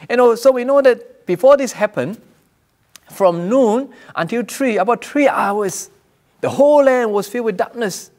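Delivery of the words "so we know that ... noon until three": ordinary talk, nothing marked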